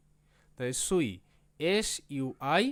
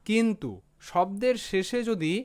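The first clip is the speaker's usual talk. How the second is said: ordinary talk, nothing marked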